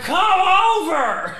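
high, drawn-out imitation holler. Hammered neighbor calling across the street, slow mock yell. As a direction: stretch it out like a hammered neighbor yelling. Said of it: "redneck hammered when he was yelling at us".

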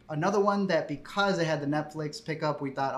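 ordinary delivery

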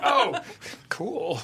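high, laughing "oh, cool"; mock surprise. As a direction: feign a startled high oh. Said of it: "I'm like".

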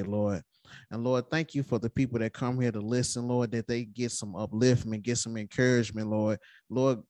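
heartfelt opening prayer, Lord at each turn; spoken prayer. Acted. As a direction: pray over the listeners. Leads a prayer before episode.

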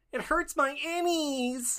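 high, very slow catchphrase voice; requested catchphrase. Pretend. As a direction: stretch it out high and slow for the fan. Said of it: "And [name], please say".

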